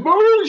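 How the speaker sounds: a sudden very high, loud howling "Boo!"; a sharp, yelping howl; imitated dog howl. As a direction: mimic the dog howling right in someone's face. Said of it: "with her howl right in his face".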